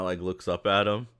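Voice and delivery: usual speaking voice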